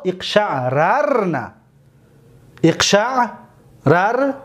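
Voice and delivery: اقشعررن said slowly, doubled r clear; reciting forms slowly; slow drill. Shown as a demonstration. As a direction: stretch each imperative form. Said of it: "imperative drill".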